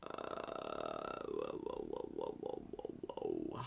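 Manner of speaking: very low, drawn-out joke whoas; mock album sound. Joking to co-host about a new album, very low droning whoas. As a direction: drone the whoas down deep like a parody track. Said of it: "That's the sound of my new album".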